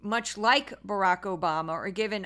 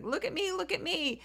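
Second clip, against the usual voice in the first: much higher, squawky look-at-me; mock a chicken begging for attention; high mocking attention-seeker; mocking show-off; 8 semitones higher, 4 dB quieter than usual. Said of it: "acting like the chicken".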